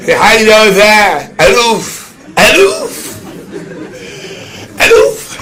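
hammed-up high yelps; squealed cries. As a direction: squeal it, climbing until the voice nearly vanishes. Acted high cries in story.

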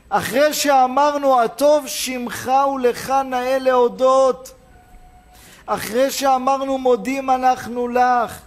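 high, loud, drawn-out proclamation; impassioned preaching. Acted. Preacher recalling the thanksgiving blessing to the congregation, impassioned preaching.

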